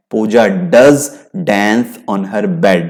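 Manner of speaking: "does" pressed, slow pace; stress on does. Shown as a demonstration. Teacher countering blame, stressing does. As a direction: weigh "does" in "Puja does dance". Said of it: "मैं कह रहा हूं करती ही है".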